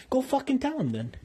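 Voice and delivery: ordinary delivery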